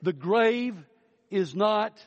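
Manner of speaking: triumphant pulpit build; preaching. Acted. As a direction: lift toward the grave's defeat. Climactic preaching on new body.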